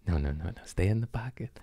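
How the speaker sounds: soft unvoiced whisper; whisper to self. Telling himself to stay calm, whispering. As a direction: whisper it, keep it secret. Urging himself during the trip.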